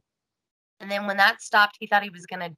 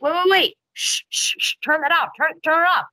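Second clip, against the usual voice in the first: higher-pitched urgent shushing, lightly acted; urgent halting; 5 semitones higher than usual. Host as rescuer halting the jackhammer, mock urgent shushing. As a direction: hush everyone and stop the machine.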